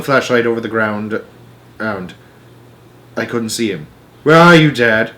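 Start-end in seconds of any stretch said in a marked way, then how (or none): 4.2-5.1 calling out. A narrator's reading. Boy searching in dark.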